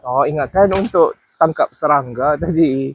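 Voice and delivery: ordinary talk, nothing marked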